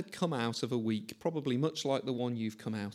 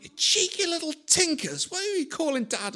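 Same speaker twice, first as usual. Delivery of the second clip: much higher, mock-offended squawk; indignant retort; 13 semitones higher, 3 dB louder than usual. Cyclist preacher shouting back at teenage lads, mock indignant and high. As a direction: play up outraged dignity in a high comic voice. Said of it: "I'm like".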